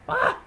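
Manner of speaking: sudden high yelp of fright; startled shriek. Acted. Marcia caught by the player, high startled shriek. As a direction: squeal as if caught off guard.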